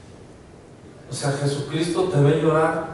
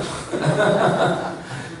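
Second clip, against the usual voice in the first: real chuckle after own remark; laugh; 3 semitones higher than usual. Real, not acted. Preacher amused by his own old retort, laughing. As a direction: laugh it off briefly.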